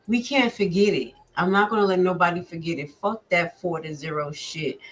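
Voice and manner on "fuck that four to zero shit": ordinary delivery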